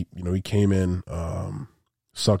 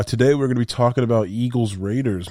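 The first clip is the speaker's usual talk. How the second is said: ordinary talk, nothing marked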